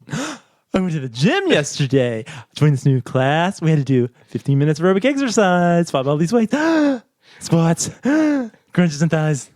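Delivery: high, excited gym story in dog talk with gasps; workout chat in dog talk. Shown as a demonstration. Reading a dog-talk gym script to his cohost, high, gasping. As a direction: gush about the gym like praising a puppy, with gasps. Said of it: "content mismatched dog-directed speech".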